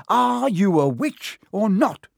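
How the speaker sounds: high, slow, exasperated bellow. A narrator's reading. Ron demanding magic from Hermione, bellowing. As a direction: holler the question word by word. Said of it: "Ron bellowed".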